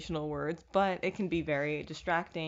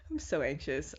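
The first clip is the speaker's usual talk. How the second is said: laughing at own anxiety; 4 semitones lower than usual. Real, not acted. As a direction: laugh it off while admitting anxiety.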